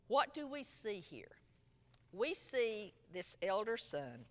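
usual speaking voice

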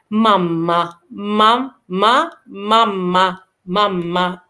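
slow "ماما" with a long hummed m; doubled m. Shown as a demonstration. Teacher drilling double m slowly, doubled m. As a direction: split "ماما" on a lingering double m. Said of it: "ام ام هنا بقول".

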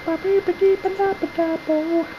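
very high-pitched nonsense syllables; squeaky scat. Pretend. Player repeats the silly jingle, squeaky scat. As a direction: babble it way up in a tiny high voice.

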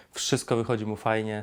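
normal talking voice

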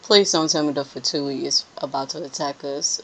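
normal talking voice

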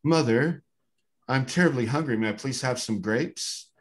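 put-on a very proper little kid asking nicely; well-spoken kid. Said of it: "the most well-spoken kid I've ever heard".

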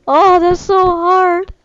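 high, loud, slow lament; mock anguish. Real, not acted. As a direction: wail over the loss, hammed up. Dying in the game.

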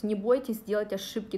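ordinary talk, nothing marked

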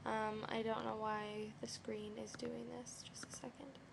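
unvoiced whisper under breath; whispered aside. Real, not acted. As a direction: mutter it aside to yourself. Aside while fixing the screen.

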